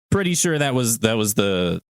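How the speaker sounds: ordinary delivery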